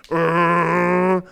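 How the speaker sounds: long droning "errr"; loud, sustained growling hum; loud revving noise. Acted. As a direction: imitate a machine cranking up. Imitates the pressure rising.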